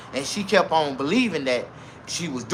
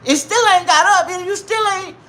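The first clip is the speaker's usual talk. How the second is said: put-on old lady voice; 13 semitones higher, 3 dB louder than usual. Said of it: "she actually said".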